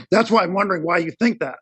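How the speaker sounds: strained, failing voice; losing his voice. Real, not acted. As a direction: voice giving out mid-sentence. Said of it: "And now I'm losing my voice".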